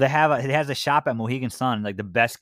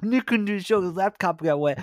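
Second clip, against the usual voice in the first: mocking whiny voice; 8 semitones higher than usual. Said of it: "you'll be like".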